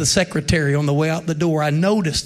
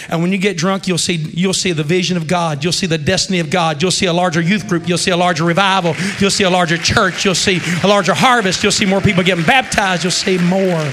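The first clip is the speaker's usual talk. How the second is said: rising, ever louder list; building preaching; 6 dB louder than usual. Real, not acted. Preacher building a promise to the congregation. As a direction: build the crowd up line by line.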